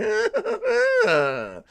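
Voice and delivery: very high drawn-out cackle; hammed-up gloating laugh; mock villain cackle. Streamer pretending greed over donations, high mock cackle.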